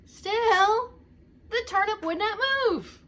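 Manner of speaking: usual speaking voice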